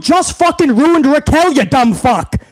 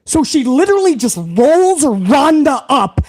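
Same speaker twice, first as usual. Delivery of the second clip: usual speaking voice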